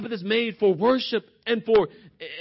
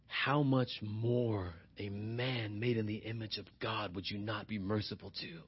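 hushed appeal; 9 semitones lower, 11 dB quieter than usual. Real, not acted. Preacher appealing for mercy to a man made in God's image, hushed. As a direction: sink into a grave hush. Drops voice for Christ's central appeal.

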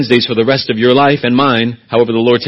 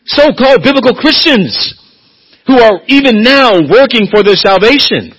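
much higher, loud rebuke; indignant preaching; 10 semitones higher, 5 dB louder than usual. Acted. Preacher denouncing so-called Christians, loud and high. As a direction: cry it out in disbelief. Preaching peak against works.

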